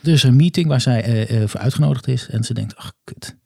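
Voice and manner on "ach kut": whispered curse. Acted. Goddess curses under her breath.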